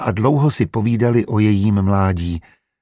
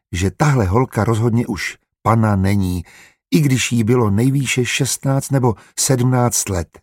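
ordinary delivery

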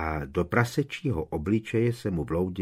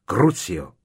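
much louder roared spell; roared curse; 8 dB louder than usual. A narrator's reading. Harry roaring a curse at Amicus, furious. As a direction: hurl the curse with a roar. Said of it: "zařval ještě".

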